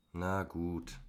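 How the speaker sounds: reluctant, mildly sighed consent; sighing. A narrator's reading. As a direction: give in with a slight sigh. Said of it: "seufzte ich".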